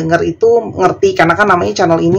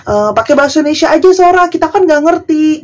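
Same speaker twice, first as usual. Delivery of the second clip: high, rushed mock viewer voice; imitating viewer complaint; 8 semitones higher, faster than usual. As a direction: whine it fast like a fussy viewer. (meniru penonton yang protes, tinggi dan cepat)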